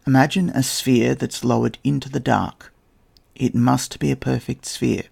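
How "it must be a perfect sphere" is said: ordinary talk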